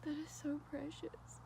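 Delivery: hushed so the babies stay calm; whispering. Real, not acted. Cooing over newborn baby animals.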